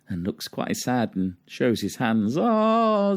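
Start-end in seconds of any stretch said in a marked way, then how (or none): 2.4-3.2 high, drawn-out "oh"; storybook mother exclaiming; mamma's exclamation. A narrator's reading. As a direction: let the cry leap up in pitch. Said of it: "said Mamma".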